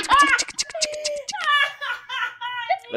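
high, drawn-out tic tic clicks; keyboard tick sounds. Retold or imitated. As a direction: mimic typing on a keyboard. Imitating keyboard typing sound.

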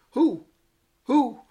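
high, loud hooting owl "Who? Who?". Retold or imitated. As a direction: hoot it like a wise owl. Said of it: "The wise owl".

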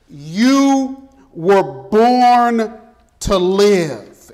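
slow, drawn-out proclamation; booming motivational speech. Acted. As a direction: preach each word slowly and firmly. Preacher repeats the theme slowly.

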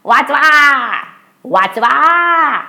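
high, drawn-out plea; cry for help. Acted. Gardener cries out after being beaten, high call for help. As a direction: call for rescue, stretching the end. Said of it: "Mangu माळ्याचा आवाज आला".

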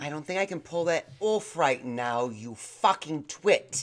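put-on British accent, slowing on the insult; mock-indignant British, drawing out the last words. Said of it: "speaking in your British accent".

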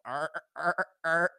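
put-on short, high "arr" noises like an old man; old-man sea lion bark. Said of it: "sounded like an old man going".

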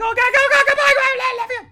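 high loud hurried goodbye; exuberant goodbye. Real, not acted. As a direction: shout it on the way out. Signing off.

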